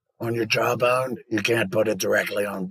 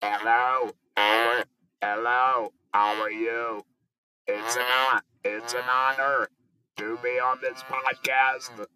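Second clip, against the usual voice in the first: form words over a steady electronic hum; electrolarynx voice; 3 semitones higher than usual. Shown as a demonstration. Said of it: "Trying to get the better voice".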